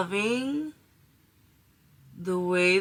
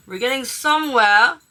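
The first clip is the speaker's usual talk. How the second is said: pleased emphasis; 4 semitones higher, 6 dB louder than usual. Real, not acted. Pleased progress report while applying.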